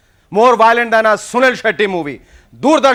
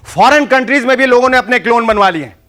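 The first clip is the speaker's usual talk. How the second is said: normal talking voice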